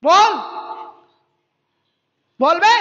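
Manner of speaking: loud jeering call to answer; taunting shout. Real, not acted. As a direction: yell at him to say something. Taunting a silent opponent on call.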